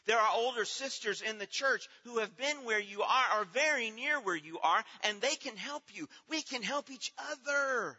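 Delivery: high, loud rising sermon voice; fervent preaching. Acted. Preacher exhorting grieving mothers in church, fervent preaching. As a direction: lift the congregation with conviction.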